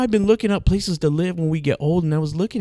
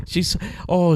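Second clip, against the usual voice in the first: normal talking voice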